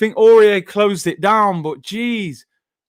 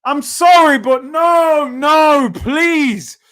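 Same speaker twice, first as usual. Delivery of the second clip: loud pleading no; despairing shout; 5 semitones higher, 5 dB louder, much slower than usual. Real, not acted. Fan pleading with his team mid-match, despairing shout. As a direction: beg the team to stop, loud.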